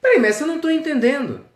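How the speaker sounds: mais agudo e alto, perplexo; discípulo confuso. Acted. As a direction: pergunte confuso ao mestre. (discípulo sem entender o mestre, agudo e perplexo)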